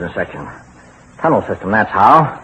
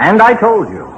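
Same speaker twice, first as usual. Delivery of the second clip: higher, louder impatient retort; irritated snap; 11 semitones higher, 5 dB louder than usual. Acted. Husband snaps at his wife over the elevator, irritated. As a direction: cut her off sharply.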